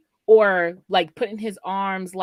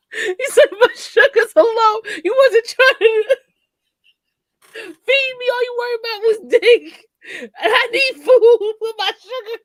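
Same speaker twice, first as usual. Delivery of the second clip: real laughing fit; 13 semitones higher, 7 dB louder than usual. Real, not acted. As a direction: quote him through high, loud laughter. Laughing too hard to retell quote.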